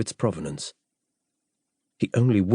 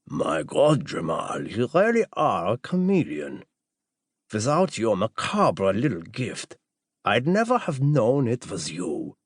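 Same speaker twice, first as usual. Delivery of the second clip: slow, drawn-out doctor voice with swooping pitch; doctor character; slower than usual. Acted. Doctor recognising Jamal in disguise, slow marvelling character voice. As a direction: marvel at the disguise, stretching the words.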